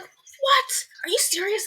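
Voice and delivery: gasped, breathy "What?" then high disbelief; shocked disbelief. Retold or imitated. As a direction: caught completely off guard. Hearing the uncle mowed her lawns.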